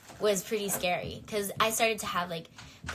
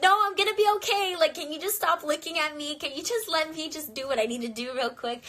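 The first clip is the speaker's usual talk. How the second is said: panicked pleading; 7 semitones higher, 4 dB louder than usual. Retold or imitated.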